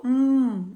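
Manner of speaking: "hum" as a held mmm, no vowel; isolated M sound. Shown as a demonstration. Teacher naming the little sound, isolated hummed M. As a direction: hum the bare M phoneme for "hum". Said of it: "É o sonzinho do".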